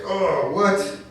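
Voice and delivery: loud, high fake-innocent yelling; hammed-up shocked parent; mock outraged parent. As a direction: play dumb loudly to the clerk. Feigning shock to store staff.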